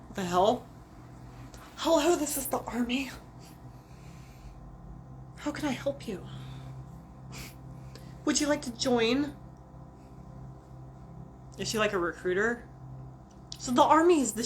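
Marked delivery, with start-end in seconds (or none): 1.8-9.3 lightly voiced chirpy operator, higher; a hint of a perky switchboard greeting; mock customer-service voice, pitched up; phone operator. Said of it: "She is the phone operator for the Army".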